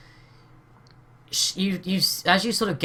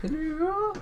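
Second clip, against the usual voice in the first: mock high hello. GM echoing player's hello high.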